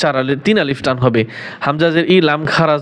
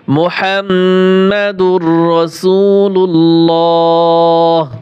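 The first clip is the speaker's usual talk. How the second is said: slow Quranic recitation; 4 dB louder, much slower than usual. Shown as a demonstration. Teacher chants kalima.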